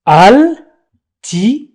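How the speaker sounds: altitude slowly broken into al, ti, tu, de; syllable by syllable. Shown as a demonstration. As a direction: sound out altitude with a pause after each syllable. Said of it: "La première syllabe".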